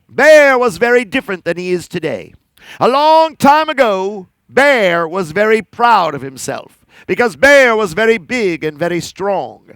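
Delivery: plain reading voice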